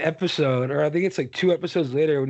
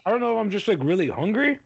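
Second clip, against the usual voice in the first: usual speaking voice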